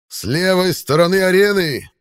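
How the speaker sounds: loud high herald's cry; town crier. Acted. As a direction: bellow it across the arena like a herald. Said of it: "возопил глашатай". (громкий клич глашатая)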